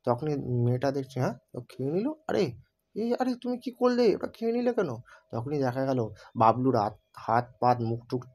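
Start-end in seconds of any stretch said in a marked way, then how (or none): 1.2-4.9 put-on high, startled girl's voice, much higher; shocked girl. Girl shocked as Bablu eats the artifact, high alarmed cry. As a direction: gasp it out as a stunned young woman.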